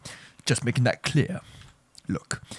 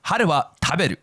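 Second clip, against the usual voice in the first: put-on Japanese accent; 6 semitones higher, 4 dB louder, faster than usual. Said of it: "let's read in a Japanese accent".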